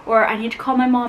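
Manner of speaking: usual speaking voice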